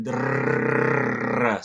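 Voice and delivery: "drrrr" as a long trilled R; long rattling alveolar trill on "drrrr"; rolled R. Shown as a demonstration. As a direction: roll the tongue hard through "drrrr" like a machine gun. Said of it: "call it a rolled R".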